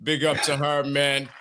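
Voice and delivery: ordinary delivery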